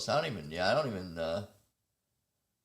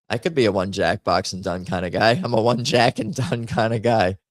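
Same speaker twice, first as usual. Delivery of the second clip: loud host welcome, a bit lower; louder radio-host delivery; podcast host voice; 3 semitones lower, 11 dB louder than usual. Acted. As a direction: open the show like a podcast host. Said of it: "Let's go back to podcast guy".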